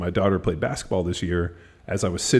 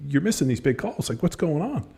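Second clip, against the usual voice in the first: higher-pitched mock protest to a referee; retold sideline griping, pitched up; indignant complaint; 8 semitones higher than usual. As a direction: challenge the ref, voice climbing at the end.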